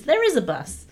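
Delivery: ordinary talk, nothing marked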